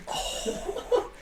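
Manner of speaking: ordinary delivery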